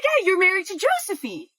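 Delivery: high, eager confirmation; eager recognition. Retold or imitated. Woman confident she has placed him.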